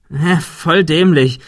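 a put-on groan at his own stupidity; mock self-scolding. A narrator's reading. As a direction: playfully tell yourself off. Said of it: "als wollte er sich selbst rügen".

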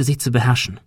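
plain reading voice

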